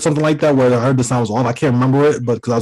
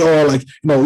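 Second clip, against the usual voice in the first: usual speaking voice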